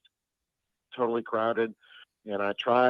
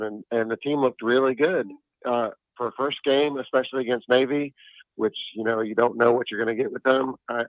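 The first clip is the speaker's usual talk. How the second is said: ordinary talk, nothing marked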